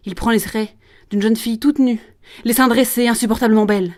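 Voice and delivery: feverish tirade. Acted. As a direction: get carried away by the vision. Promoteur's tirade builds to outburst. (tirade enflammée qui monte)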